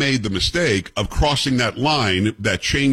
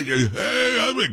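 mock drunken yell; 11 semitones higher, much slower than usual. Retelling a drunk heckler at a concert, mock yell. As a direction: imitate a heckler calling out. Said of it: "some drunk guy yelling at me".

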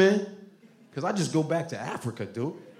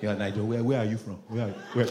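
put-on low, quieter Nigerian accent; 5 semitones lower, 5 dB quieter, faster than usual. Nigerian asking where are you from, Nigerian accent. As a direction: interrogate him in a Nigerian accent.

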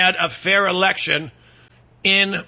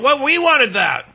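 higher, drawn-out complaint; mock protest; 6 semitones higher, 3 dB louder, slower than usual. Wisconsin objecting to Michigan getting the peninsula, mock indignant protest. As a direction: play a put-out objection, stretched and high.

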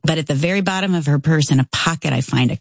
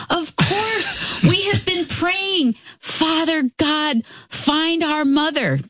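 high, slow, relieved cry from the son-in-law; excited yell; 14 semitones higher, slower than usual. Retold or imitated. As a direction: call out her name, overjoyed and amazed. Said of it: "he yells".

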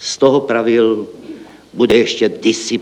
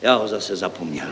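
softer, crestfallen admission; saddened; 3 semitones lower, 7 dB quieter than usual. Acted. Deputy referent forgets his idea, sadly. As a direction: let it sink dejectedly. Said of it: "sesmutnil zástupce".

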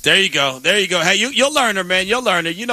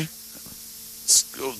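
ordinary talk, nothing marked

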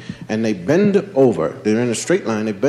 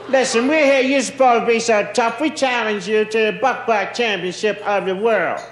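put-on tough kid challenging; 9 semitones higher than usual. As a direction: throw down the challenge like a street kid. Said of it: "And he said".

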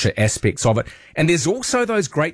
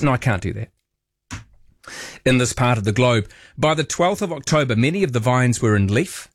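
ordinary delivery

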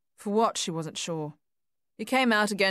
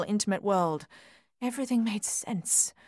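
usual speaking voice

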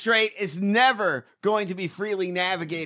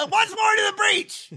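Kenneth Branagh impression; 12 semitones higher than usual. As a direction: boom it out like a stage Henry V. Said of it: "let's do Kenneth Branagh".

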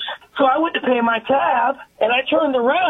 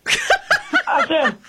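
laughing. Real, not acted.

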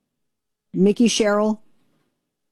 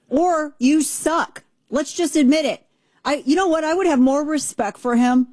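wrestler voice; 7 semitones higher than usual. Shown as a demonstration. Ranting in put-on wrestler voice.

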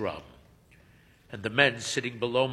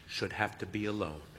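ordinary delivery

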